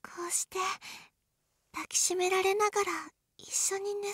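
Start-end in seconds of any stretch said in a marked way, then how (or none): 0.0-0.6 soft unvoiced whisper. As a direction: whisper close to his ear. Whispering in bed.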